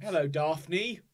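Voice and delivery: slow, drawn-out mock Manchester accent; bad English accent. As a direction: imitate the fake Mancunian from Frasier. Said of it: "The worst English accent".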